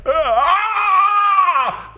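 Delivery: long, high, loud mock wail; escalating imitated yell. Host shows the comedian's yell getting worse, hammed-up scream. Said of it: "it kept getting worse".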